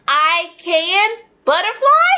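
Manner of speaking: high rising wrong answer; student's garbled answer; mimicking student. Shown as a demonstration. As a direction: play the confused kid.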